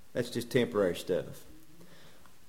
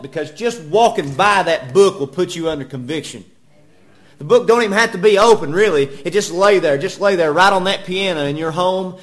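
raised, building pulpit voice; forceful preaching; 5 semitones higher, 8 dB louder than usual. Real, not acted. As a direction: build to a loud, high peak.

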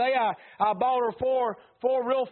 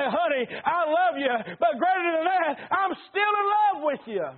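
slow, rising higher into a heartfelt in love with you; tender love declaration; 8 semitones higher, 3 dB louder, slower than usual. Acted. Husband to his wife, a slow tender declaration. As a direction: say it slowly, holding her hand and looking into her eyes. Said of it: "look into her eyes and say".

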